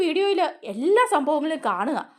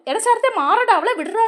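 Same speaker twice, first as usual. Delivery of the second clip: retold shout; 8 semitones higher, 7 dB louder than usual. Sudhi pulls Sharath off Shruthi.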